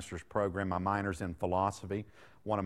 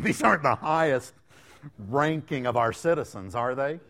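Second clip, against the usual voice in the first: laughing jab; 5 semitones higher, 8 dB louder than usual. Real, not acted. Mocking lottery winners, laughing.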